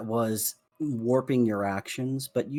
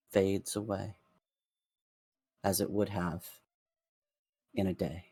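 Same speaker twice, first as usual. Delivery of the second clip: story narration; 2 semitones lower than usual. A narrator's reading. GM narrates magic release.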